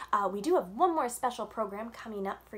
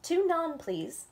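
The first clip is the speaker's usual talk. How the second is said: louder call across the stall; 8 semitones higher, 5 dB louder, slower than usual. Acted. Mama calling her order to the baker, louder. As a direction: call the order over the crowd. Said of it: "Mama called out".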